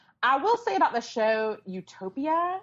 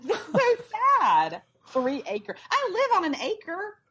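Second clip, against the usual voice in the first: mock indignation; 8 semitones higher than usual. Mock outrage at tiny show land.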